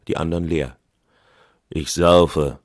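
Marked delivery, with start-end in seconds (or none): 1.7-2.6 louder, hollow voice from the grave; sepulchral voice. A narrator's reading. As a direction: intone it like from a tomb. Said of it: "mit Grabesstimme".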